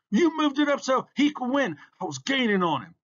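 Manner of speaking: very high, loud accusation; indignant protest. A narrator's reading. Beaten racer blaming the thread holders, outraged. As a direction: protest in an outraged squeal of blame.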